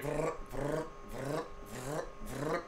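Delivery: low, soft trilled whirr; imitated dial noise. Shown as a demonstration. Host reminding dad of old phones, imitated dial whirr.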